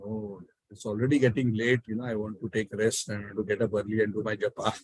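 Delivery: low, soft, retold excuse; imitating a tired priest begging off; reluctant refusal. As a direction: decline quietly, as if ready for rest. Priest wearily declines to cook.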